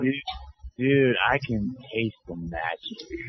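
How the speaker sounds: low, slow spaced-out stoner. Pretend. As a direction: drag it out, dazed and dreamy. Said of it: "if he would talk like that".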